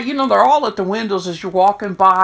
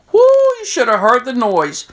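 ordinary delivery

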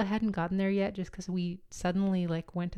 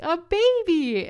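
much higher, very loud, slow shriek; shrieked exclamation; 12 semitones higher, 11 dB louder, much slower than usual. Retold or imitated. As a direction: stretch it into a full-throated cry. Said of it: "shouted that".

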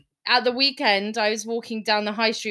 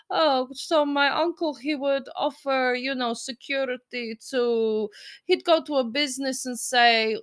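attempted Bulgarian accent. Housemate explaining his uncle's protection business, attempted Bulgarian accent. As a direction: put on a rough, Russian-sounding Bulgarian accent. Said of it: "So I can't do a Bulgarian accent".

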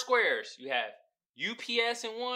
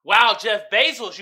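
ordinary talk, nothing marked

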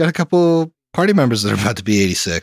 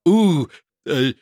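higher, drawn-out showy drink name; mock cocktail naming; 5 semitones higher, slower than usual. Host mocking how a friend names rum and Coke, hammed-up announcing. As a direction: announce a silly drink name, high and slow. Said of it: "like if Emily named a fucking rum and Coke".

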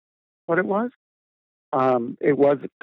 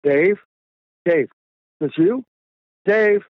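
calling a name into the dark, rising louder; shouting his friend's name in the woods; 5 semitones higher, 5 dB louder than usual. Retold or imitated. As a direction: yell for a friend at night, anxious. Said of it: "And then I yelled out".

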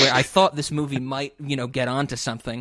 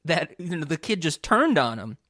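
mock surprise; 6 semitones higher, 4 dB louder than usual. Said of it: "I'm like".

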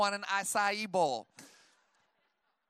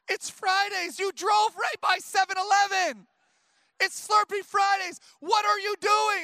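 much higher, louder imitation of upset kids; mock panicked yelling; 12 semitones higher, 8 dB louder than usual. Dad imitating kids protesting a missed Slurpee stop, mock yelling. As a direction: yell it like an outraged child, hammed up. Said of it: "they're, like, yelling at me".

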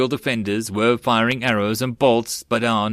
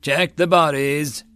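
higher, drawn-out field command; called-out order; 5 semitones higher, slower than usual. A narrator's reading. Sergeant to Marines after the ambush, calling out. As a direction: call the order slowly across the square.

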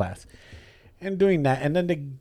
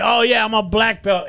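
mock braggart; 8 semitones higher, 3 dB louder than usual. Braggart voiced in dojo story.

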